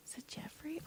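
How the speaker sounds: unvoiced whisper to herself; whispered aside. Real, not acted. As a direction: whisper the doubt. Unsure aside to self.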